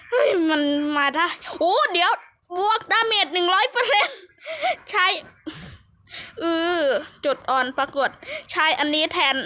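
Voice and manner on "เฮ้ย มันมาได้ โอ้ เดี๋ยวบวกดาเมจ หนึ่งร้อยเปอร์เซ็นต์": thrilled surprise. Real, not acted. Player spots a full damage bonus, thrilled surprise. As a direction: burst out in delighted disbelief.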